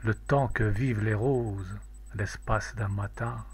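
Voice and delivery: plain reading voice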